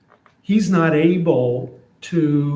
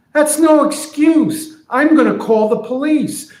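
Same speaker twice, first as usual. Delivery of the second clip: much higher, heated friend; indignant friend; 11 semitones higher, 4 dB louder than usual. Retold or imitated. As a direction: friend threatening to call the police. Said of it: "So here's what I'm imagining".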